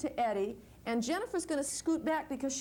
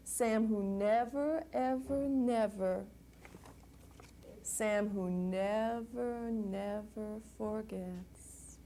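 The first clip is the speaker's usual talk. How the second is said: slow and fading toward the end; bedtime-story ending, slowing and softening; slow closing refrain; 2 semitones lower, slower than usual. Acted. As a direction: let the last refrain wind down.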